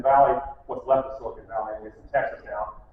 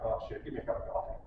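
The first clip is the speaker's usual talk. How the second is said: softer under-the-breath grumble before a fight; bracing mutter; 5 semitones lower, 13 dB quieter than usual. Retold or imitated. Salesman bracing to face an upset customer, under his breath. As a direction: gear up, grumbling to yourself. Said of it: "you gear up".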